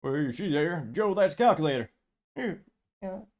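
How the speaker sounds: a second, fuller go at Obama, admitted to be bad; Obama impression. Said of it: "could I do Obama?".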